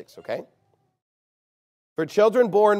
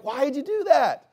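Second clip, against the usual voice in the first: parent's scolding; 9 semitones higher, 3 dB louder than usual. Retold or imitated.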